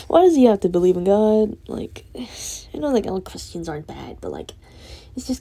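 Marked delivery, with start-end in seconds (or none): 0.1-1.6 exasperated cry. Real, not acted. Exasperated outburst about crush.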